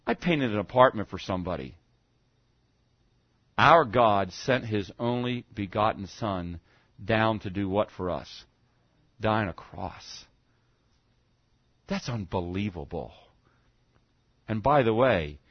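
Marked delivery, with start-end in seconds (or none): 3.6-15.4 slow, soft and low, letting each phrase land; hushed reverent preaching. Acted. Preacher tells the congregation of the cross in hushed awe. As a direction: speak of the cross in a hushed, awed voice.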